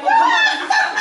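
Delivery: very high, loud, laughing invitation; squealy invite. Retold or imitated. Friend inviting her upstairs with a high laughing squeal. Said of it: "And she was like".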